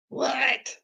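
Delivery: high shocked what; surprised. Real, not acted. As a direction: blurt it out in disbelief. Shocked at missing song.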